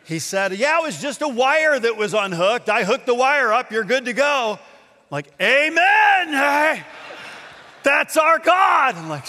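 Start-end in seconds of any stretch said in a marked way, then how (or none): 0.5-4.5 higher, louder acted good news; excited announcement. Mechanic telling everyone the bus is fixed, excited. 5.4-8.9 high, loud hallelujah cheer; triumphant shout. Acted. Believer celebrating the fixed bus, triumphant shout. Acted celebration of answered prayer.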